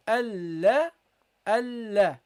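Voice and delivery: Allah in two drawn units, AL then LA; separate units. Shown as a demonstration. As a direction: say Allah slowly as al-la, holding the L. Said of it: "as a separate unit".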